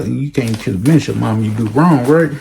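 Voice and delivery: normal talking voice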